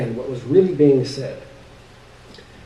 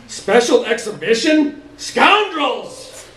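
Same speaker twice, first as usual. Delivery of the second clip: outraged shout; 9 semitones higher, much slower than usual. Acted. Uncle scolding the Beatles for insulting Imelda, outraged. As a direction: spit out the insult, scandalized. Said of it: "he only grew angrier".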